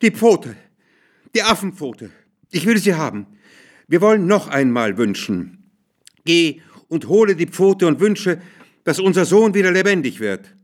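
hoch, laut und wie besessen fordern; wilder Aufschrei. A narrator's reading. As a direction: verzweifelt drängende Frau, schrill erhoben. (wild aufschreien)

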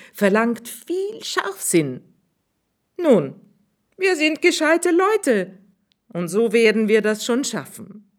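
a sudden bright idea; high swineherd, triumphant; swineherd's voice. Acted.

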